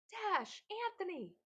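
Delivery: high, loud calls of two names; raspy shouted names. A narrator's reading. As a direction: shout the boys' names hoarsely. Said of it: "Eric shouted in a raspy voice".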